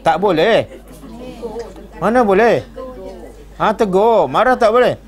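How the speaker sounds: ordinary talk, nothing marked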